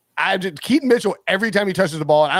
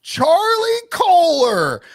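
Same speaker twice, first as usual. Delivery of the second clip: long drawn-out, higher broadcaster call; Al Michaels call; 14 semitones higher, 4 dB louder, much slower than usual. Retold or imitated. Imitating a play-by-play man calling the touchdown, drawn out. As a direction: stretch the name like a touchdown call. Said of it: "what you can hear him say".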